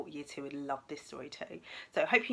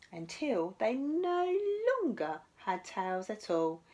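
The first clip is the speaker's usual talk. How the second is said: lively, expressive picture-book reading, higher than her own chat; storytelling to children; 6 semitones higher, 10 dB louder, slower than usual. Acted. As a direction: read it to little ones with wide-eyed drama. Teacher reads picture book aloud.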